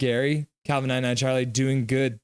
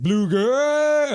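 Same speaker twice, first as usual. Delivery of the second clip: high, louder drawn-out cheer; mock cheer; 11 semitones higher, 5 dB louder than usual. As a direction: belt it like a fan in the stands. Acting out a chat cheer.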